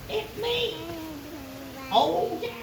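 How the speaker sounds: put-on high, quavery old woman. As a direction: play a frail old woman at the door. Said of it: "she's like an old, old woman".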